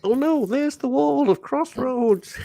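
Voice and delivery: high, slow, wobbly soap-opera damsel; mocking a hammy soap-opera actress, high and slow; hammed soap actress. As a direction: overact like bad daytime TV, giggling. Mocking Crossroads acting.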